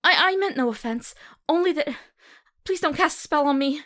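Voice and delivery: high, loud, panicked apology; frightened pleading. Acted. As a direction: beg not to be cursed, voice jumping up.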